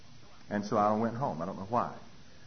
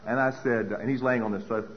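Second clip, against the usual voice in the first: ordinary delivery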